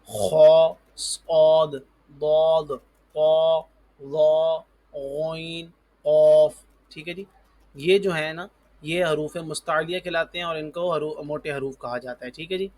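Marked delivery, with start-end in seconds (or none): 0.1-6.5 خو، صاد، ضاد، طا، ظا، غین، قاف called out loud and thick, one by one; heavy letters demo. Shown as a demonstration. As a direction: belt out the full-mouthed heavy letters. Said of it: "اچھا وہ کون کون سے ہیں؟". (موٹے حروف، زور سے ادا کریں)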